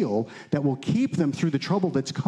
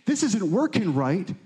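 higher, insistent retold exhortation; lightly voiced John the Baptist; 6 semitones higher than usual. Prophet telling people to admit their failing, mildly insistent. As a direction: urge them in a raised preaching voice. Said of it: "He's like".

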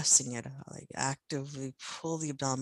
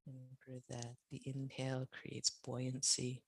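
guided meditation. Acted. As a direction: settle them into rest gently. Guides Shavasana relaxation.

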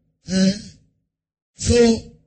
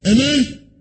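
ordinary talk, nothing marked

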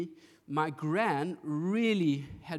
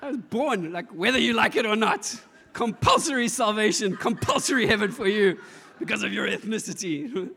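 loud, higher, emphatic declaration with laughter; mock proclamation; 3 semitones higher, 7 dB louder than usual. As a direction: proclaim it like a compulsory decree. Mocks gran's idea of salvation.

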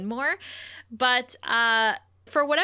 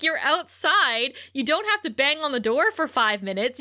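normal talking voice

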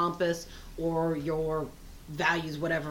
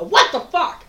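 much louder, higher furious yelp; sudden office rage shout; startled outburst; 11 semitones higher, 16 dB louder, slower than usual. Retold or imitated. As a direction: blurt it out at the screen. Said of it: "my immediate reaction is to go".

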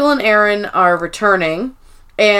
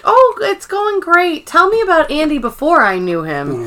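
chirpy Erin act; 7 semitones higher than usual. Retold or imitated. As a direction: lightly play up the cheery reply. Cheery Erin voiced in recap.

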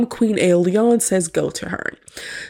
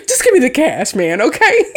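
higher, words through giggles; laughing; 4 semitones higher, 4 dB louder than usual. Real, not acted. Host wanting cash instead of earrings, laughing. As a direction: plead jokingly while cracking up.

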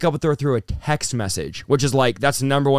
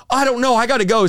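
high-pitched, mildly panicked excuse; retold flustered panic; 8 semitones higher than usual. Student needing to leave class, mock flustered panic. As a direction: blurt it as a flustered retelling.

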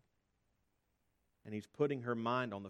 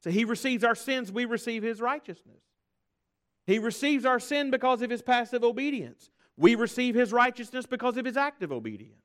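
high, loud pulpit proclamation; fervent, louder, higher sermon delivery; full-force preaching; 11 semitones higher, 11 dB louder than usual. Acted. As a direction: preach it out to the whole church.

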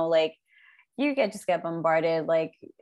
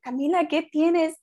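worried aunt; 9 semitones higher, 4 dB louder than usual. Retold or imitated. Mother asks crying niece.

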